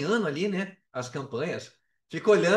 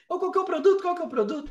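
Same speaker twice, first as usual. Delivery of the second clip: much higher, louder mock grab for the product; pushy leech networker; 13 semitones higher, 4 dB louder, faster than usual. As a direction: mimic a greedy bloodsucker demanding the product. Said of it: "sanguessuga ali". (imitando um sanguessuga interesseiro, bem mais agudo e alto)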